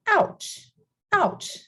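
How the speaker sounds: high falling "ouch", as if toe stubbed; mock pained ouch. Teacher imitating someone who stubbed toes, mock pained cry. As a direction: pretend sudden pain, high then dropping. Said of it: "when you hurt yourself or you stumbled".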